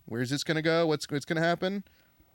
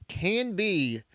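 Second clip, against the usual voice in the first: ordinary delivery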